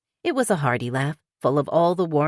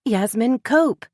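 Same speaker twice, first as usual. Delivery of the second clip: excited realization; 10 semitones higher, 5 dB louder, slower than usual. A narrator's reading. Sudden realization.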